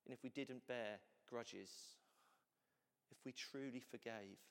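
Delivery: ordinary talk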